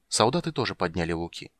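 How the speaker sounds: usual speaking voice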